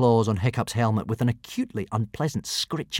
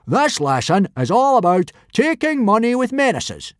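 loud, high teacher's bellow; yelling; 12 semitones higher, 5 dB louder than usual. A narrator's reading. Teacher announcing the lesson, yelling. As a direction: yell it to the whole class. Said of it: "yelled Gobber".

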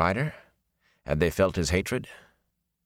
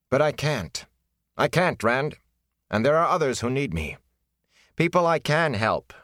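emotionless and firm; 7 semitones higher than usual. Acted. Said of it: "her voice emotionless and firm".

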